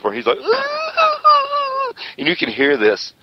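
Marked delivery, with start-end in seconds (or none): 0.4-1.9 long, very high mock wail; drawn-out squealing sob, hammed up; imitated bawling. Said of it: "starts bawling in his hands".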